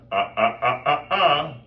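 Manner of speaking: put-on sharp, loud glottal "ah" repeated like scolding a child; glottal strike. Shown as a demonstration. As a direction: strike each "ah" from closed cords. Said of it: "what's called a glottal strike".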